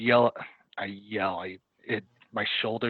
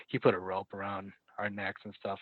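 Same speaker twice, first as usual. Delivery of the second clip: ordinary talk, nothing marked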